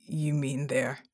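low, halting rumble; deep character voice, trailing off. A narrator's reading. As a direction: rumble it low, leave it hanging. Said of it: "he rumbled".

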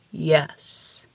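low giant's rumble; rumbled. A narrator's reading. The mountain answering the babbling detective, rumbled. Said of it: "he rumbled".

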